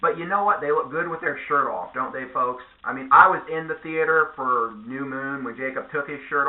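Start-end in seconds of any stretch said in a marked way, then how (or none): none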